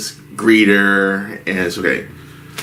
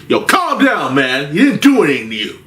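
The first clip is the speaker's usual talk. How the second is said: imitating the bartender; 6 semitones higher, faster than usual. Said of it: "I hear from our bartender is saying".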